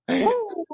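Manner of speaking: high-pitched "woo" of disbelief; high whoop. Real, not acted. Reacting to his own blunder.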